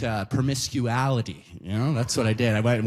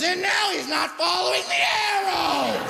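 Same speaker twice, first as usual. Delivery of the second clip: high, piercing cry of a rule-stickler; shrill tattletale shout. Retold or imitated. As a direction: shriek it out to the whole store. Lady tattling on him from behind.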